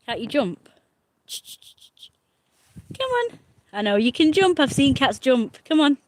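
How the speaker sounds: high coaxing pet voice. Real, not acted. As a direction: coax the cat in a sing-song. Cooing to a cat.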